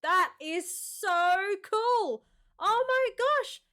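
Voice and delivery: slow, stretched-out, delighted emphasis; gush, drawing out the key words; thrilled amazement. Real, not acted. Reacting to ashes sent into space.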